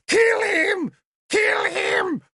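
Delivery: high, loud shrieked chant; frenzied scream. A narrator's reading. Druid leaping and screaming for death, shrieking. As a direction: scream for blood.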